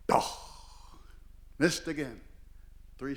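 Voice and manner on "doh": short vocal rifle bang; abrupt mouth-made bang; imitated gunshot. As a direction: mimic a rifle going off. Imitates the second shot.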